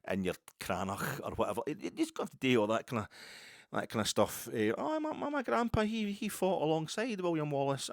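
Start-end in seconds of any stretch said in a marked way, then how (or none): 4.8-7.9 put-on high, camp movie Scots accent; mock Hollywood Scottish accent, much higher; hammed-up cinema Scot. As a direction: boast like a tourist-brochure Scotsman. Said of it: "stereotypical cinema Scot".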